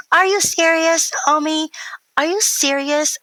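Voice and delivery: put-on high, slow, loud kid voice; drawn-out childlike disbelief; imitating little boy. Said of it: "he'll just be like".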